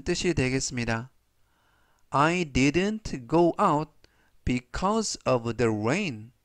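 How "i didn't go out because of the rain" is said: very slow, clear sentence read for repeating; slow model reading. Shown as a demonstration. Teacher reading slowly for students to repeat. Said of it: "제가 역시 천천히 세 번씩 읽어드리고요".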